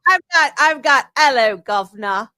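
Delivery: mock cockney hello governor; chirpy put-on London greeting; British accent. Co-host teasing with a British accent. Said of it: "You said you were talking a British accent".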